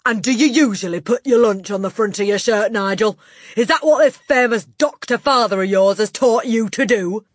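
loud, high sarcastic questioning; domineering headmistress, scornful. A narrator's reading. Headmistress mocking the boy's doctor father, loud and scornful. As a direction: pile scorn on him at full volume.

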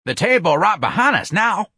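high, straining worker's voice; effortful and urgent; breathless. A narrator's reading. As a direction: reassure while heaving a load. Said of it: "a lifter said breathlessly".

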